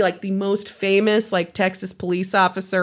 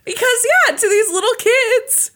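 mock sad. Mock lament about children and Santa.

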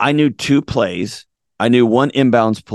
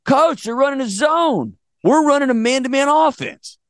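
put-on urgent kid voice, pitched way up; insistent player; 13 semitones higher than usual. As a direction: imitate an exasperated player explaining. Player insists to clueless coach.

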